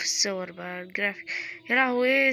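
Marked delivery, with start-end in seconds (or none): 1.7-2.3 sudden loud "يا لهوي" in alarm; startled cry. Real, not acted. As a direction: blurt it out, genuinely startled. Panic seeing the settings. (صرخة مفاجأة حقيقية)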